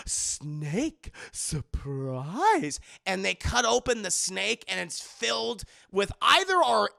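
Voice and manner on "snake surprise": low gleeful guest. Retold or imitated. Guest announcing the dish.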